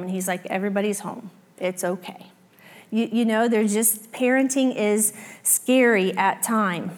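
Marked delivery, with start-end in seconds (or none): 0.5-2.3 softly reassuring, lower and quieter; calm her down gently. Retold or imitated. Husband reassuring her at night.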